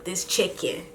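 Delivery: softly voiced mock amazement; soft first take of a retold rave; imitating mom. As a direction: say it low in volume, holding back. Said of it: "she was like".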